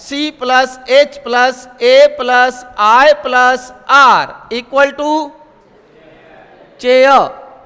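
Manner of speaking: very slow, letter by letter; slow letter-by-letter spelling. Shown as a demonstration. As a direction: spell each letter out slowly. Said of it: "teacher spelling letters slowly".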